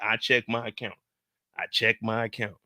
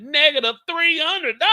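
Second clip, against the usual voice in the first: higher, louder robotic readout; machine's verdict; 13 semitones higher, 7 dB louder than usual. Retold or imitated. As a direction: deliver the balance like a cold machine. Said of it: "That motherfucker said".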